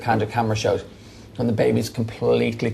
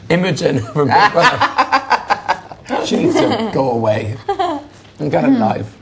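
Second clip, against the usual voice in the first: talking through laughter; words stretched out by laughter; laughing; 2 semitones higher, slower than usual. Real, not acted. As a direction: laugh while you explain. Laughing at the housemate's text.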